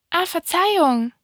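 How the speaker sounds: high-pitched little prince apology; apologetic little prince. A narrator's reading. Little prince begs the fox's pardon, high-voiced.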